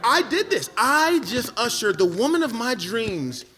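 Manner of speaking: high-pitched comic despair; distraught. Retold or imitated. Man realizing he led his friend to her, distraught. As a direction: agonize over your own blunder.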